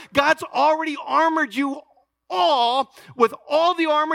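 rising preaching fervor. Real, not acted. Pastor preaching at full force.

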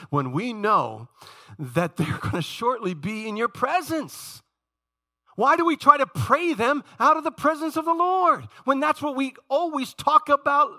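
high, loud rhetorical challenge; impassioned preaching. Real, not acted. Preacher challenging church on praying for the dying, impassioned cry.

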